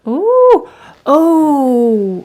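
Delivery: high, loud delighted ooh; thrilled by a surprising color; excited. Real, not acted. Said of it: "making me more excited".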